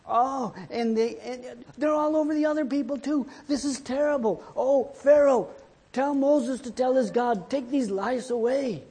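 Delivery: high-pitched wailing plea; frantic mock pleading. People begging Pharaoh about the lice, high frantic wail. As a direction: wail in hammed-up desperation, pitch way up.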